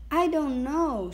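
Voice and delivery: lightly acted tearful protest; choked. A narrator's reading. Girl starting to cry before the soldier, choked. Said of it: "her voice choked".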